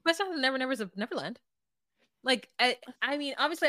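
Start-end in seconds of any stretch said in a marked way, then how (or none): 0.0-1.4 rattled off fast. Retold or imitated. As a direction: rush "Never Nevers of Neverland" together in one quick breath. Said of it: "She says it so fast".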